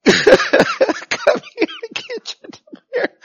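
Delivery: high-pitched giggly order; laughing. Shown as a demonstration. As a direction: hand out the demerit while laughing. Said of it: "You want laughter?".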